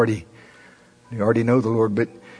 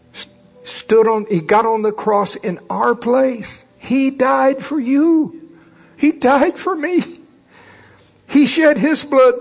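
voice breaking; 10 semitones higher, slower than usual. Real, not acted. Voice breaking over the cross.

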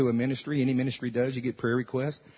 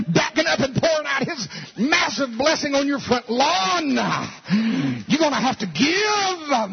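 Noah impression; 7 semitones higher than usual. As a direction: ham up a pushy offering appeal. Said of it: "I'll do my Noah impression again".

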